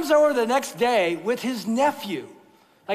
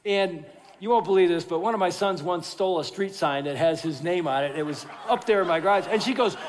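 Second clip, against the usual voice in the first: ordinary talk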